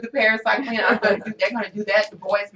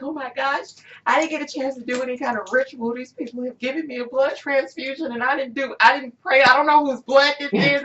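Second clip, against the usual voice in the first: higher-pitched, mildly panicked retelling; retold freak-out; 6 semitones higher than usual. Patient recalling a transfusion without prayer, freaking out. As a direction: rattle it off in a rising mock fluster. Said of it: "I'm freaking out".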